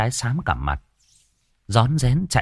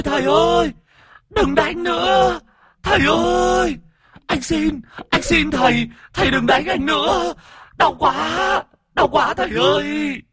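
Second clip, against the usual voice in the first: high, wailing ghost begging for mercy; whimpering plea. Acted. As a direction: wail and beg like a beaten spirit. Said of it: "mếu máo van xin".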